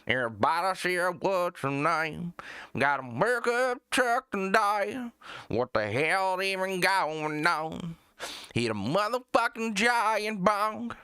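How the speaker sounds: high, twangy mock country singer; generic country singer. Streamer mocking country singers who sound alike, high drawn-out twang. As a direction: draw out a put-on country twang. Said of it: "They'll have, like, that same exact voice".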